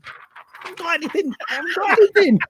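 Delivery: high, strained panic; mock panic with nothing to play; panicked thought. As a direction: squeak it out in rising alarm. Panicking with dead studio desks.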